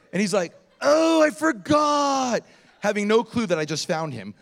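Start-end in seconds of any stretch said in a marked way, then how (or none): 0.8-2.4 slow, drawn-out high holler; loud oblivious man. Retold or imitated. Homeless man calls out to visitors, hollered imitation. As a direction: imitate an awkward man bellowing, pitched up. Imitates Donald calling out loudly.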